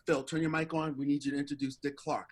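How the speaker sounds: normal talking voice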